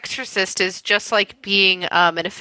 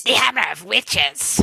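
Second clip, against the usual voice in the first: hammed-up echo of a book title; mock-dramatic title. Co-host echoing the translated book title, mock-dramatic. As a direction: play up the title in a put-on voice.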